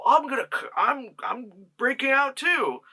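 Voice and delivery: hammed-up announcement in a high voice; mock escapee voice. Voices character deciding to escape.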